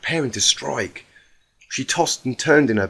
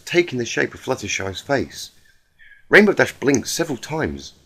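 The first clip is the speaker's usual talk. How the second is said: ordinary delivery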